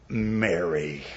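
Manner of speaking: Jesus gently speaking Mary's name in a familiar tone; familiar tender tone. Retold or imitated. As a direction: say her name low and gentle, like an old friend. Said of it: "probably said in a familiar tone".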